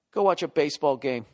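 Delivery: normal talking voice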